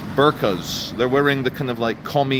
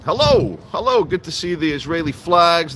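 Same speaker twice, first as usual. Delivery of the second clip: cheery greetings; 5 semitones higher than usual. Real, not acted. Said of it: "hello everyone".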